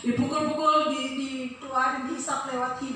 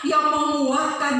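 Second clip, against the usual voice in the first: high, loud, sing-song sermon cadence; preaching at full force; 9 semitones higher, 4 dB louder than usual. Acted. Pastor reminding the church of God's word, sing-song. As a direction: lift the promise in a chanted call.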